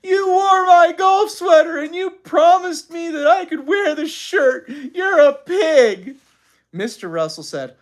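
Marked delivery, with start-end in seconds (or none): 0.0-6.2 loud, high-pitched bawling; sobbing. A narrator's reading. Mother losing the shirt quarrel to father, sobbing. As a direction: wail it, dragging out the last words. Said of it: "She sobbed".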